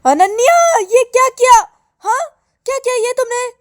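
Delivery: shrieked, high, loud accusation; furious shout. Acted. Girl enraged at seeing the flower moved, furious shout. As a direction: yell the name, then demand an explanation. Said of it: "बहुत ही और भी गुस्सा हो जाती है".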